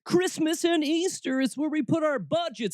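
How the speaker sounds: high-pitched, pleading churchgoer voice; mock church defender. Host voicing church folk defending holidays, mock pleading. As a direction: keep it high and insistent throughout, mimicking an excuse. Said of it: "easy for us to be able to say".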